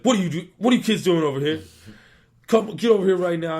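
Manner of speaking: put-on high, loud drill-sergeant shout at the kids; mock military yell. Sergeant major catches kids smoking behind the greenhouse, hammed-up high loud yell. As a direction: yell at the kids like you caught them red-handed.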